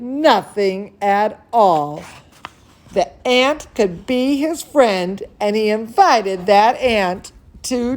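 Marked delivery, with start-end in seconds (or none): none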